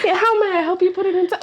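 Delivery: polite clerk voice, laughing through it; mock help-desk greeting breaking into laughter; customer service voice. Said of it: "My customer service voice".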